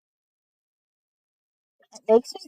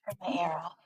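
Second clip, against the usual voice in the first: unvoiced whisper aside; hushed whispered aside; 3 semitones lower, 14 dB quieter than usual. Real, not acted. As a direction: whisper it off-mic. Whispering during livestream.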